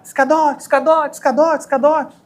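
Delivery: high calling shout across the factory; imitating a coworker yelling over machines; retold shout. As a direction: call out from ten metres away. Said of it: "ele começou a gritar".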